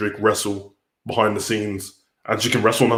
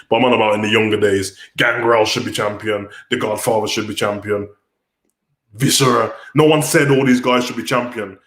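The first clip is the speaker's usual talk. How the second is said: normal talking voice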